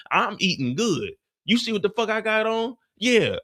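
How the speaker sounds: ordinary talk, nothing marked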